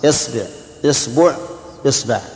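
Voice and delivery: إصبع with kasra on the hamza, then the ba in three vowels; isba with i. Shown as a demonstration. Teacher drilling students in the kasra forms of إصبع, pronunciation demo. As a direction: say إصبع as isbe, isbu, isba. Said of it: "كسرها".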